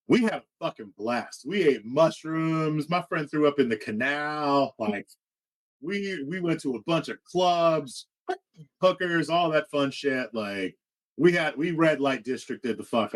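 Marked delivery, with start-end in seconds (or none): none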